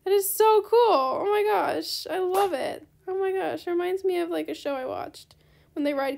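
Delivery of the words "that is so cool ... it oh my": gushing squeal. Real, not acted. Gushing over carriage.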